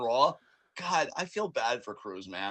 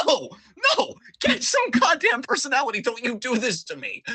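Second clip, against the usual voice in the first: mock outraged yell; 8 semitones higher, 6 dB louder than usual. Mock yelling at Apollo Crews.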